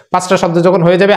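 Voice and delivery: normal talking voice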